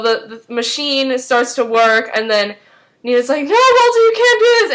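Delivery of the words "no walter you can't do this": high, loud cry of protest; acted alarmed shout. Nina trying to stop Walter crossing over, acted alarmed shout. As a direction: shout it as if stopping someone, hammed-up.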